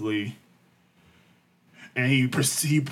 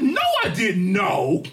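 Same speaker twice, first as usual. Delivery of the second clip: stunned; 8 semitones higher, 4 dB louder, much slower than usual. Retold or imitated. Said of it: "I said".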